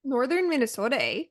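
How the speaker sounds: put-on playful Canadian accent with a lifted "eh"; Canadian-style Minnesota vowels; mock Canadian accent. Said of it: "debate last night about the Canadian accent".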